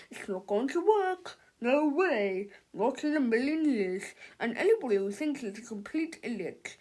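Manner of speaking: smug, dismissive father; scoffing. A narrator's reading. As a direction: prove the kids wrong. Father scoffing at kids' idea.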